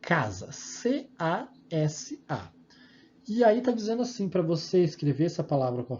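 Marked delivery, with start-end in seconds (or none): 0.5-2.5 C, A, S, A slowly, letter by letter; slow, separated letter names; spelled out. Shown as a demonstration. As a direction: spell C A S A with a gap after each letter. Teacher spelling out the word.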